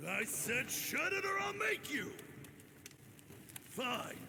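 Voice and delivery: furious yelling. Acted. Commander raging at the singing prisoner, furious yell. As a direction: scream at her to stop.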